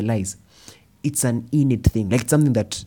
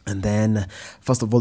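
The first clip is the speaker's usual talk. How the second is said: ordinary delivery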